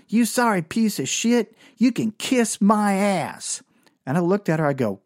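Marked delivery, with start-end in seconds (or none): 0.1-3.6 angry hooker. Retold or imitated. Hooker cussing him out.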